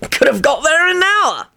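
high, teasing jibe; gleeful call. Acted. Sirius mocks the eighty-mile flight, gleeful. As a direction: crow it high with glee. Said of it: "called out gleefully".

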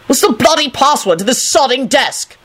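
high, exasperated angry demand; violent shout. A narrator's reading. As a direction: spit the curses out furiously. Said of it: "Lupin shouted violently".

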